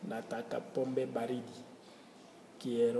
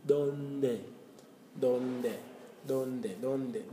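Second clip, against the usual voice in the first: ordinary delivery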